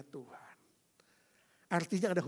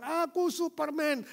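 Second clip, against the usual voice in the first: put-on higher, slow child's proud cry; boy shouting Superman; 11 semitones higher, 5 dB louder than usual. Pastor voicing the boy shouting just before the lights go out, high proud cry. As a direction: shout it like a kid hero. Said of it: "Waktu dia teriak kencang".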